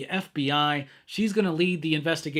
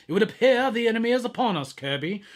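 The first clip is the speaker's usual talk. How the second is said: higher, fast solemn prophet voice; 4 semitones higher than usual. Shown as a demonstration. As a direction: proclaim it like a biblical prophet. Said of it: "that's my Prophet voice from now on".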